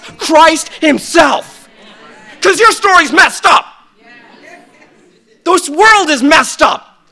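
slow, loud, high shout; preaching shout. Real, not acted. Pastor crying out to the church, slow shout. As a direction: hammer the name home.

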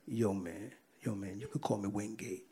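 low, hushed imitation of an inmate; mock whisper. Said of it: "He kinda whispered and said".

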